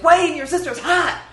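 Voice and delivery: high, loud shout while running away; yelled quote. Retold or imitated. Imitating Squirrely Dan yelling to Wayne, high and loud. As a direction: call it out as you flee. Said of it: "Squirrely Dan just goes running away and goes".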